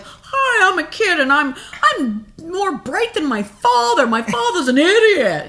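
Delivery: put-on high, slow bratty sitcom kid. Teasing a dad about sitcoms, in a high bratty kid voice. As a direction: play a smug sitcom kid mocking his father. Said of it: "And it's like".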